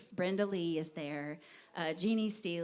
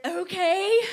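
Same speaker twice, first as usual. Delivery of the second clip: surprised okay; 10 semitones higher, 11 dB louder than usual. Retold or imitated. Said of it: "I was like".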